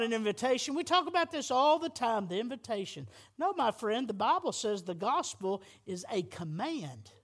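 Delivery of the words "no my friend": ordinary talk, nothing marked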